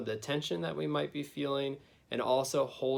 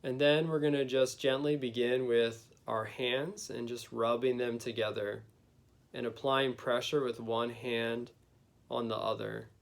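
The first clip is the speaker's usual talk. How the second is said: guided meditation. Acted. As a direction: gently lead them inward, unhurried.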